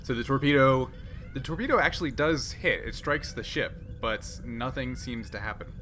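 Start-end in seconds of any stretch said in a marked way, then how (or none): none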